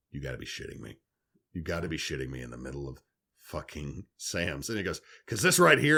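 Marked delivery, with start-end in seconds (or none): 0.1-4.7 low, much softer, under the breath; muttered disbelief. Retold or imitated. Disbelief at stranger, aside. 5.3-6.0 higher, louder, pushy and chummy; hammed-up stranger selling his point; mock eager stranger.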